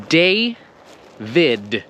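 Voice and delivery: David with a firm released final D; David, final D clearly heard; strong final D. Shown as a demonstration. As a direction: land the D of David hard. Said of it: "Do you hear how the D".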